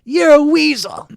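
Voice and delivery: high, loud jeer; taunting yell. Acted. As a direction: yell the insult across the courtroom. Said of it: "jumps up and yells".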